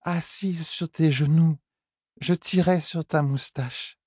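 high, slow, slurred words; slurred drunk Elaine. Acted. Elaine tugging Tom's moustache, slow, slurred and high. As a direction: drag the words out like a tipsy girl. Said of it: "les mots paraissaient pâteux".

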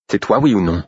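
a touch of fury, pressing for an answer; girl pressing a stranger, mildly furious; furious demand. A narrator's reading. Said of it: "Elle semblait furieuse".

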